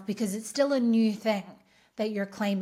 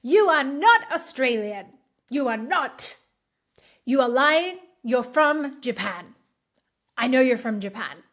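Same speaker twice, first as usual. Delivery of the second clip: a stranger's hammed-up angry scream; imagined screaming; 3 semitones higher, 5 dB louder than usual. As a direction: shout the accusation in mock outrage. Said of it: "comes up to me and screams".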